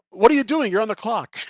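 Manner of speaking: loud, high scolding shout; angry barkeep yell. Acted. Barkeep yelling at his worker on the clock, loud and high. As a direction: bark at the slacking worker.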